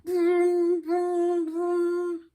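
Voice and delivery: very high, loud vroom; alarm clock ringing. Acted. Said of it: "went the alarm clock".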